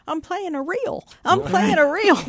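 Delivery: imitating a thrilled musician; excited. Musician retelling bandmate's joyful discovery, excited high voice. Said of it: "he said".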